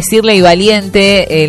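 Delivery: ordinary delivery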